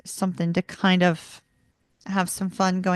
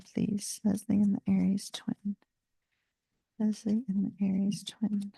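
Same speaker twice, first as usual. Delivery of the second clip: whisper; 7 dB quieter than usual. Real, not acted. Whispered request to the cards.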